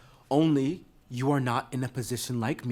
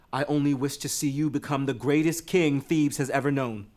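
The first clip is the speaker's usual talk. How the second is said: ordinary talk, nothing marked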